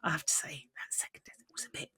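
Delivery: hushed confiding whisper; breathy aside, barely voiced. Real, not acted. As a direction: lean in and whisper it like a secret. Confiding aside about boring match.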